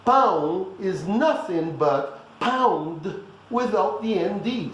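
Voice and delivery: slow and deliberate, spelling out the sound; slow emphatic reminder. Shown as a demonstration. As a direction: drive the rule home slowly. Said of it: "Just remember".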